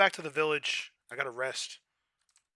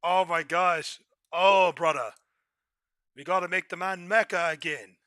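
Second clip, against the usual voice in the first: louder put-on Islander voice; Royd voice; 2 semitones higher, 9 dB louder than usual. Playing the game character Royd, loud put-on Islander voice. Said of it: "Royd is a hard voice to do".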